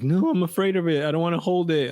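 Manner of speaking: put-on high, whiny frightened kid voice; mock scared kid. Son scared to feed his gecko, high whiny fear. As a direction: imitate a panicking boy. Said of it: "he's freaking out".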